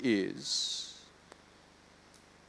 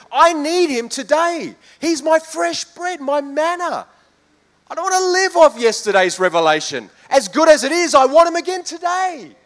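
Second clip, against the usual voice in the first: high, loud, soaring preacher's cry; full-force preaching; 12 semitones higher, 7 dB louder than usual. Acted. Preacher urging the congregation into the new year, high and loud. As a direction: preach it out with rising fervour.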